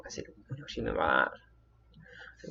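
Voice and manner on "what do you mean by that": hushed, low confused query; puzzled low question. Retold or imitated. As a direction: lower and softer, quietly puzzled. Repeats the question twice.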